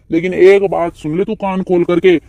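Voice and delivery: normal talking voice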